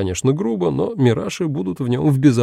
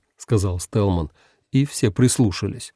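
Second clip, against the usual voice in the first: ordinary delivery, nothing marked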